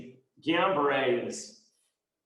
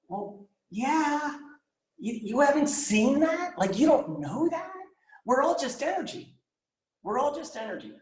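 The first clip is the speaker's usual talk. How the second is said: incredulous; 10 semitones higher than usual. Retold or imitated. Amazed reply to friend's late insight.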